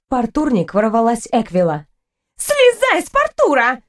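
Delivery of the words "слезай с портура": high-pitched, drawn-out furious demand; irate girl, pitched far up; angry cry. A narrator's reading. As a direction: order them off in a high, angry voice. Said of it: "гневно крикнула мне девушка".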